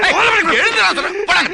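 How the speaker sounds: high-pitched insistent shout; angry order. Acted. Guest forcing the groom off his seat, angry shout. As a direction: order him up, voice jumping high.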